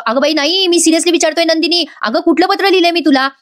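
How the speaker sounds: high, pleading insistence; insistent protest. Acted. Husband seriously asking wife which letter, exasperated insistence.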